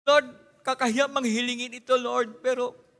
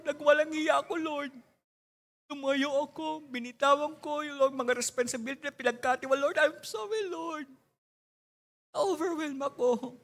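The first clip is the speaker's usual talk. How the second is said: repentant prayer. Acted.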